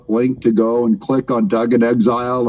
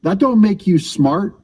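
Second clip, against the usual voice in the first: mocking retort; 7 semitones higher than usual. Real, not acted. Scolding a caller.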